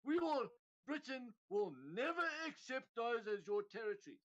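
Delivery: high-pitched mock defiant declaration; Liz Truss. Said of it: "she said".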